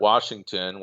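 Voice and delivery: usual speaking voice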